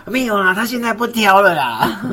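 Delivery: high, loud, slow teasing assurance; teasing insistence. Retold or imitated. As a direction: insist playfully, drawn out. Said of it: "我说：".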